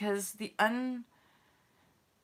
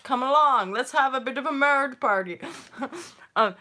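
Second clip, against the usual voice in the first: ordinary talk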